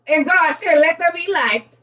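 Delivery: high, loud mock biblical line; playful preachy quote; excited. Real, not acted. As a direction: proclaim it jokingly.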